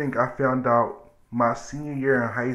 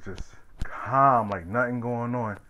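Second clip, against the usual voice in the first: normal talking voice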